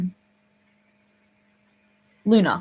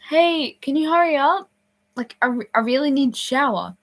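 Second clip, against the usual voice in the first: higher, louder urgent plea; urgent call; 6 semitones higher, 4 dB louder than usual. Retold or imitated. Camper knocks to hurry the showerer, urgent. Urging through the door.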